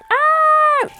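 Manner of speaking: a sudden, very high short scream; retold scream. A scared girl recalling the doll moving, screaming. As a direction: shriek it like a terrified child in the dark. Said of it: "I remember screaming".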